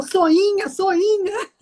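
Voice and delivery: high, playful mock voice; squeaky teasing repeat; voz aguda brincalhona. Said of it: "E ele falou". (voz aguda de brincadeira)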